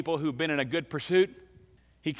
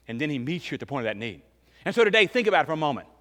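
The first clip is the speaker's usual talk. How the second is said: usual speaking voice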